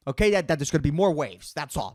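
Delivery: ordinary talk, nothing marked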